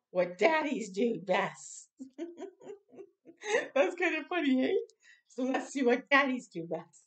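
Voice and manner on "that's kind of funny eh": laughing. Real, not acted. Amused at the flipped book.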